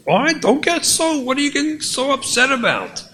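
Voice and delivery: a husband snapping back at his wife, irritated. Acted. Acted marital quarrel.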